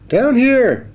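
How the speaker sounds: high little fish voice calling up. Acted. Hidden fish calling to the boy, high and bright. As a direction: call up from the pail, small and high. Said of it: "the voice called".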